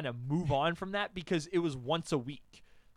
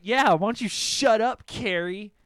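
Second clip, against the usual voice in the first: loud, higher-pitched snap back; angry comeback; 5 semitones higher, 11 dB louder than usual. Retold or imitated. Boy telling off ex Carrie, angry and loud. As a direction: fire back loudly at your rival. Acted quarrel with ex.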